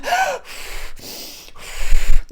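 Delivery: high, loud unvoiced panting sigh; a hammed-up breath out as the needle goes in; labour-like breathing out. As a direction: blow out air like giving birth. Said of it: "That's literally what I was doing, like I was having a baby".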